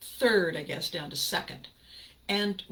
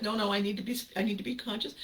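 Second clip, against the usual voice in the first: ordinary delivery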